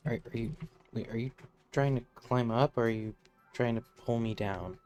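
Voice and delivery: normal talking voice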